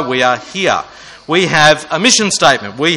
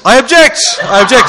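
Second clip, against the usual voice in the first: high, loud, laughing "I object!"; playful courtroom cry, laughing; mock law student; 7 semitones higher, 5 dB louder than usual. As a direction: ham up a squealing objection. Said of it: "because I live in perpetual adolescence".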